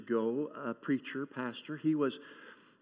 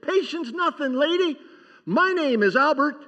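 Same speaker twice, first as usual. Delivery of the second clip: put-on much higher and louder, frazzled old man; exasperated outburst; 11 semitones higher, 9 dB louder than usual. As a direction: blurt it out at the end of his rope. Punchline of grandfather joke.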